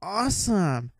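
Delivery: put-on drunk awe. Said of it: "All drunk, I was like".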